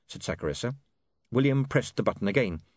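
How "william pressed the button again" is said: plain reading voice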